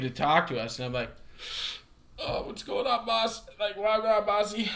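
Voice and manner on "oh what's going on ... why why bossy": higher-pitched, softened street greeting; lightly put-on, raised voice for a hello; acted greeting. Greeting a stranger who walks up, acted high greeting. Greeting a man while smoking.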